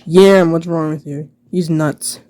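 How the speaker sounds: ordinary talk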